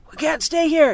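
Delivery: loud high yell. A narrator's reading. Captain telling the crew to leave, loud yell. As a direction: shout it to the crew over the wind. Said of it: "yelled the captain".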